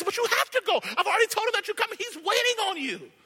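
put-on high, quick girl urging him; girl coaxing the boy to go; insistent girl voice. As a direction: push him gently but firmly.

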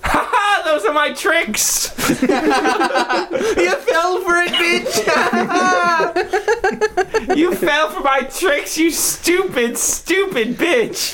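very high, giggling taunt; gloating squeaky monster. Acted. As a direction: cackle and taunt in a tiny voice.

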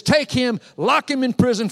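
high, loud order from an angry king; acted royal command. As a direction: order the arrest forcefully, acting the king. Angry king orders prophet jailed.

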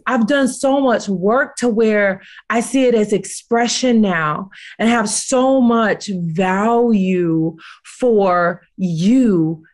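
ordinary talk, nothing marked

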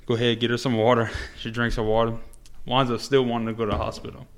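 normal talking voice

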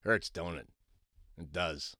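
hushed sardonic aside; quiet aside. Real, not acted. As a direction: drop it low and wry. Wry aside after phone number.

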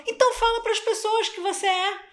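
a hint of an insistent mentor; lightly voiced coach. Coach urging, retold.

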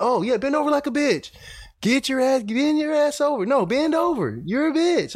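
very high mocking drill sergeant yell. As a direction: sneer it high like a sergeant taunting recruits. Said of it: "He's like".

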